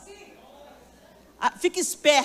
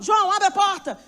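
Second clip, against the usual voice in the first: higher, louder call through a door; 7 semitones higher, 4 dB louder than usual. Retold or imitated. Grandfather calling to son-in-law through the door, loud. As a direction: demand the door be opened.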